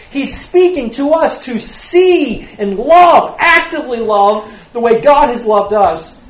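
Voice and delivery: loud, fervent preaching. Acted. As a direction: preach it out to the congregation. Preaching at full force.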